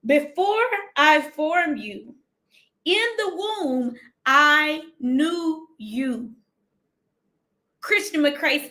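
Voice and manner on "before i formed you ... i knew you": very slow, drawn-out verse; slow preaching. Acted. Preacher repeating the verse to the live audience, very slow and moved. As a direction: stretch it out with feeling.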